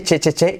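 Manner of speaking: "che" repeated with crisp ch stop; repeated CH sound. Shown as a demonstration. Teacher isolating the C H, repeated crisp ch. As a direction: tap out "che, che, che". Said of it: "con C H".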